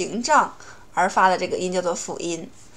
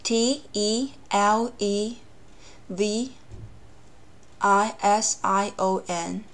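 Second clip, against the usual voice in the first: slow letter-by-letter spelling, evenly spaced; measured, drawn-out letter names; 5 dB quieter, much slower than usual. Shown as a demonstration. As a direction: spell it out slowly, each letter separate.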